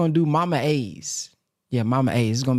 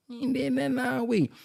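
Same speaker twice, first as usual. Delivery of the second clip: high, softer put-on scolding voice; hammed-up scolding; 10 semitones higher, 5 dB quieter than usual. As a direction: say it again in a different put-on voice. Repeats the scolding, different voice.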